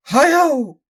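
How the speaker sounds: high, loud shrieking cry; screaming exclamation; mad old man's scream. Acted. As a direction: shriek like a crazed old man. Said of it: "screamed the old inventor".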